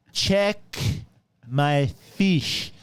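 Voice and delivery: loud, high, spaced-out demand; insistent customer. Retold or imitated. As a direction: imitate a customer repeating it, hammering each word. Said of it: "I don't know if this woman was Russian".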